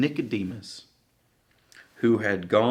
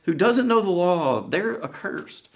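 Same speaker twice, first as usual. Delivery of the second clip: higher, scornful mock lecture; much higher huffy scolding; indignant Pharisees; 10 semitones higher, 3 dB louder than usual. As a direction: play up the snooty Pharisee. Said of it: "They say".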